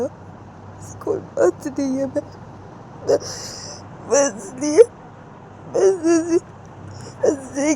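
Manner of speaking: tearful pleading. Acted. Ritu pleads, upset.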